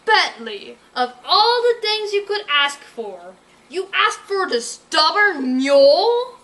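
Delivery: scoffing, high and loud, slow; mocking villain. Villain mocking Berkeley for asking after the mule, slow scoffing.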